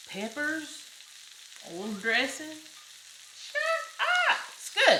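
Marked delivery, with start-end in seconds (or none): 3.4-5.0 squealed high "shut up", then louder praise; delighted exclaim. Real, not acted. Cook tasting elote, delighted high squeal. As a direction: squeal with surprise at the taste.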